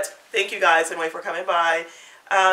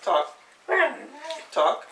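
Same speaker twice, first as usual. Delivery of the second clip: talking to a dog; 7 semitones higher, slower than usual. Real, not acted. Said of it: "I'm talking to the dog".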